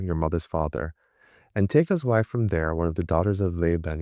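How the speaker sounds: usual speaking voice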